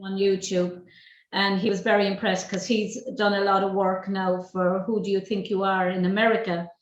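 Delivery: ordinary talk, nothing marked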